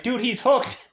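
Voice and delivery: high, incredulous protest; exasperated friend. Retold or imitated. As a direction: squeak it out in disbelief. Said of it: "And it's like".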